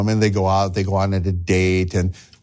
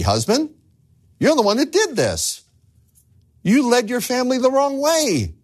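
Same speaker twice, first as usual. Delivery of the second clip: high, climbing sermon voice; fervent preaching; 11 semitones higher than usual. Acted. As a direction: preach it straight at the husband. Preaching at full force.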